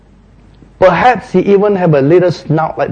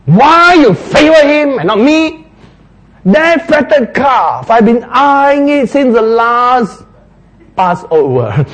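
higher, louder, slow aggrieved whine; resentful complaint; 9 semitones higher, 4 dB louder, slower than usual. Acted. Elder son accusing his father, loud aggrieved whine. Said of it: "His anger was also at the father".

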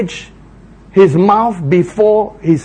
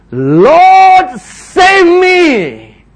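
much higher, loud pleading cry; desperate cry; 11 semitones higher, 7 dB louder, much slower than usual. Acted. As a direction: cry out as you sink. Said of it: "he says".

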